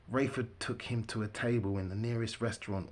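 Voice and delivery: plain reading voice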